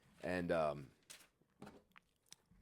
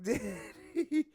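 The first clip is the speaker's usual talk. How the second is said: ordinary delivery, nothing marked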